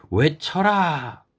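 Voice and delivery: high, long-drawn rallying call; acted battle-cry command. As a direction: call the order out, stretching it slowly. Preacher acts out the order to shout.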